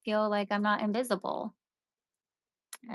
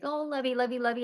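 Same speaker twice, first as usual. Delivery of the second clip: higher-pitched, gushy pet talk; mock doting; 4 semitones higher than usual. Partner lavishing love on his dog, mimicked doting. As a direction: imitate someone cooing over a dog.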